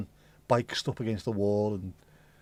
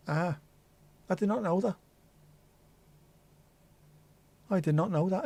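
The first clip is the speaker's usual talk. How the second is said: surprised; 6 semitones higher than usual. Real, not acted. Surprised at a chat fact.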